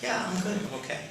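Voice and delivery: put-on high, falsely bright little-boy reply; a kid brushing it off in a high voice; forced cheerful kid. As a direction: sound fine while hiding fear. Child hiding worry from mom.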